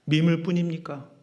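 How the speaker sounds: ordinary delivery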